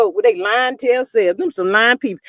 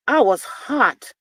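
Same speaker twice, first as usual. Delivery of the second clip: usual speaking voice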